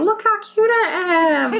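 very high, cutesy pet voice; trying to be cute. Acted. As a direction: show off in a tiny squeaky voice. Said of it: "trying to be cute".

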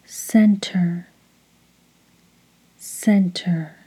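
soft repeated mantra, each word falling away; hushed, rhythmic one-word meditation cue. Shown as a demonstration. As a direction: lead a call to repeat, evenly timed. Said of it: "repeat each word after me, following the rhythm".

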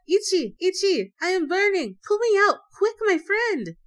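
high, loud cries of alarm; urgent, high-pitched yell for help; crying out. A narrator's reading. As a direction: cry out for rescue in short bursts. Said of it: "he cried out".

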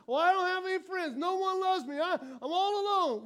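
mock a sulky loner; whiny complainer. Preacher mimicking someone who claims no friends, high and whiny.

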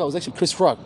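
normal talking voice

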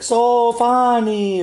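high, very slow, drawn-out mock voice; mocking so funny. Friends teasing after any joke, high slow sarcastic drawl. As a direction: drag it out, dripping with sarcasm. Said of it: "certain people will be like".

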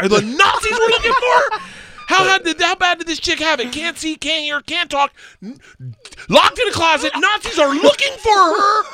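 very high, loud disbelief, building; hammed-up shocked rant, voice pushed to the top; incredulous outburst. Clueless tourist learns Nazis were looking for her, loud incredulity.

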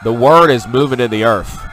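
loud, high, joyful exclamations; excited praise. Real, not acted. Vlogger rejoicing to viewers over the convoy, loud and high. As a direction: shout praise as if the crowd is with you.

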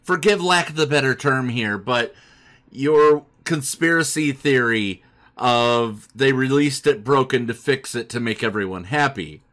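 ordinary talk, nothing marked

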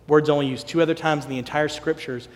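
ordinary talk, nothing marked

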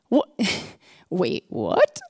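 stunned laugh, then a low, baffled what; laughing double take. Pretend. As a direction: catch yourself mid-laugh in disbelief. Stunned by the forgiveness.

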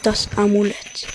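normal talking voice

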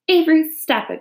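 high, sharp scolding call; crying out. A narrator's reading. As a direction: call a child to order. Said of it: "cried his mother".

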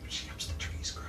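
hushed whisper. Acted. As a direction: whisper it aside to each other. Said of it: "they whisper to each other".

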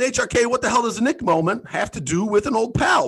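mock viewer asking; imagined viewer. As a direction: voice the confused viewer. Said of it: "I know what you're thinking".

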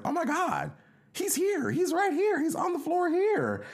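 high, loud alarmed exclaiming; excited discovery. Retold or imitated. Stranger discovering a passed-out guest, high and loud. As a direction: yelp it out like you just found someone. Stranger finds him on floor.

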